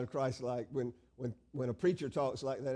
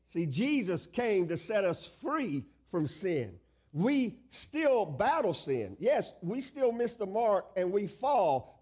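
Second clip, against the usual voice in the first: emphatic sermon with drawn-out key words; slow, weighty pulpit declarations; fervent preaching; 4 semitones higher, slower than usual. Acted. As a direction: preach it with rising conviction. Preaches freedom from sin.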